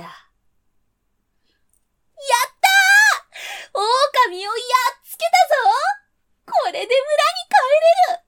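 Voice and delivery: very high, loud triumphant cheer; overjoyed. A narrator's reading. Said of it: "大喜び".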